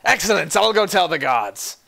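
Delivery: high, loud burst of eager delight; excited announcement. Acted. Tavern keeper seizing on a boast of winning, excited. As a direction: proclaim the duel with gusto.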